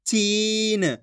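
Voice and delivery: "चीन" with a long held ee; slow, elongated "चीन" for learners; drawn-out pronunciation. Shown as a demonstration. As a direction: stretch the vowel in "चीन" right out. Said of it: "आपको खींच के पढ़ना है".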